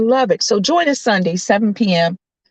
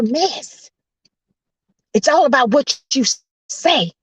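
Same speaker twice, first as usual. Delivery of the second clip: high, emphatic exhortation; preacher's hollering; 8 semitones higher than usual. Real, not acted. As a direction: holler encouragement at the congregation. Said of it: "I'm gonna stop hollering".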